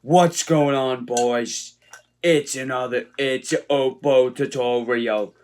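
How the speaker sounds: loud, high, slow fake Australian accent; horrible Australian accent. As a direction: greet the boys like a bad Aussie fitness host, slowly. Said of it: "That is a horrible Australian accent".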